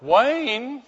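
high, rising questioning call; alarmed calling out. Acted. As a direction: imitate an uneasy wife calling into the dark. Wife calls again, now more alarmed.

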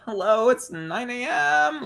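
high, drawn-out sarcastic hello; mock incredulous. Driver to the garage lady at nine A M, incredulous. Acts out greeting unwelcome garage attendant.

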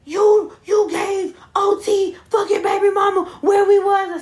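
loud, high mocking yell; mock furious yell from the phone call; angry yell. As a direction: shout it at her down the phone.

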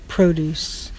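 produce stressed on PRO with a long O; produce, first syllable stressed; noun produce. Shown as a demonstration. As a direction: say produce as PROH-dooce, like vegetables.

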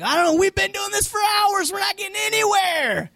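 high, worn-out rower grumbling; weary disciples. Retold or imitated. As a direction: play the exhausted oarsman. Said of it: "you can see 'em like".

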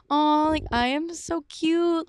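high, drawn-out self-adoring coo; fond gushing. Retold or imitated. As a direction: coo over how cute you were, stretching it out. Gushing over old pictures of herself.

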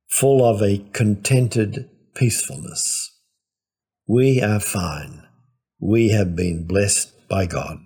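ordinary talk

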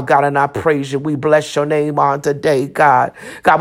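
usual speaking voice

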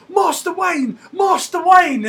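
high, loud excited calling across a cafe; hammed-up butler hollering for his master. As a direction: shout his name across the room, laughing. Alfred calling out to Bruce in cafe.

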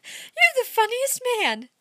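put-on a laughing girl teasing her partner; amused young woman. A narrator's reading. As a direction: draw it out, amused and coy. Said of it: "Miss Goodrich laughed again".

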